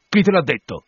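high, loud, sudden challenge; captain's sharp outburst; cried out. A narrator's reading. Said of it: "gridò Acab".

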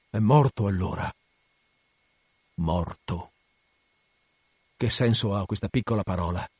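low, hushed brooding; grave realisation. Acted. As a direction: let the death sink in quietly. Captain absorbs news of a death.

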